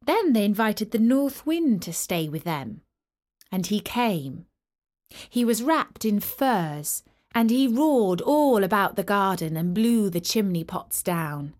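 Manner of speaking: plain reading voice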